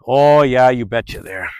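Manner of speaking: put-on playful Midwestern Wisconsin accent. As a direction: lay on a folksy Wisconsin accent. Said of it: "getting my accent back".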